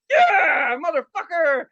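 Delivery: high, loud fan's cheer; exhilarated yell. Retold or imitated. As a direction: whoop at a fireball on the track. Reenacts cheering the fireball.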